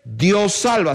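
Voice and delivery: ordinary talk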